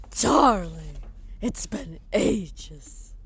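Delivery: slow, low, drawn-out character voice; quoted character. Acted. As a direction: draw out the words like a quoted line. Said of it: "If anybody gets that reference".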